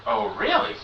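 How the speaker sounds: squeaky pitch jump; high and eager. Acted. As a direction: perk up in a high voice. Gnome perks up at sale.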